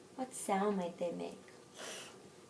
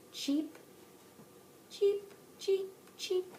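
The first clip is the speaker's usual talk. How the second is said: bird chirping; 10 semitones higher, much slower than usual. Shown as a demonstration. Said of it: "the birds are gonna say".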